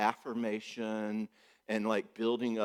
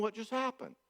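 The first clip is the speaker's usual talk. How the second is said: bewildered; 10 semitones higher, 5 dB quieter than usual. Retold or imitated. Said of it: "you're going".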